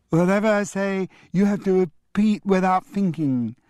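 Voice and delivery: put-on high parroting little boy; childish echo. A twin parroting grandfather's rule, high and squeaky. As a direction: copy grandad in a squeaky kid voice.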